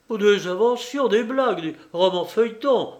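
high, loud indignant protest. A narrator's reading. Banker protesting Lupin's story, high and indignant. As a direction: scoff at it as pure fiction. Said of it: "protesta le banquier".

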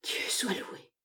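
soft, whispered exhale; hushed breath of thanks; relieved sigh. A narrator's reading. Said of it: "avec un soupir de soulagement".

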